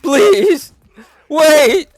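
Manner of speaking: high, loud panicked pleading; fluttering cries for help. Pretend. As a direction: shriek for mercy in mock panic. Cries for help while grabbed.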